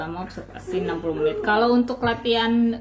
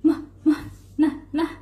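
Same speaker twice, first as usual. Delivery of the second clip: higher, babbling like an infant; baby babble; 6 semitones higher than usual. Retold or imitated. Imitating a baby's babbling, higher and cooing. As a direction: coo the syllables like a baby. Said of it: "anak bayi kan suka ya".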